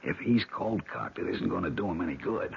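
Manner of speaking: hushed. Acted. Sneaking past armed zombie below.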